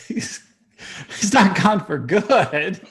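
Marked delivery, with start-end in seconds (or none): 1.2-2.8 emphatic denial. Acted. Joking denial of the spell.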